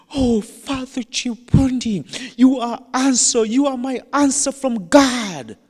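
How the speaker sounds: high, loud, acted amazement; overwhelmed exclamation. Startled woman greeting the priest, acting overwhelmed. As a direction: gush it loudly, way up in pitch.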